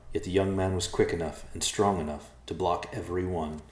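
ordinary delivery

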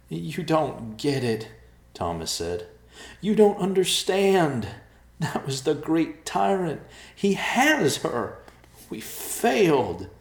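slow, loud anguished pleading; distraught shout. Acted. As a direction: rant in despair. Thomas distraught, tyrant took her.

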